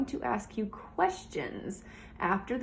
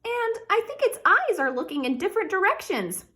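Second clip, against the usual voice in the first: much higher, louder little princess; a small girl refusing her gift; 10 semitones higher, 4 dB louder than usual. A narrator's reading. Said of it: "said Princess Pinecone".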